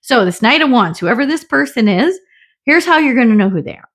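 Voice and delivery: normal talking voice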